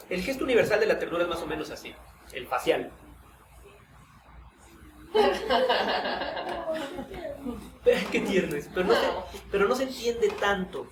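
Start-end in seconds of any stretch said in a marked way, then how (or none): 5.1-8.0 high, bright burst of laughing; laughing with the students; real laughter. Real, not acted. Laughing at class reaction.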